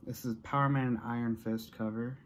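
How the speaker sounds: usual speaking voice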